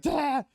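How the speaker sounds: high sharp yelp; alarmed yelp. Retold or imitated. His attorney's alarmed high yelp. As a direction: mimic the lawyer's panic.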